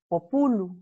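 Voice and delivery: "populu" stressed on the middle syllable, po-PU-lu. Shown as a demonstration. Teacher shows the expected fixed-accent Latin pronunciation, stress on the U. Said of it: "tendría que haber sido la pronunciación".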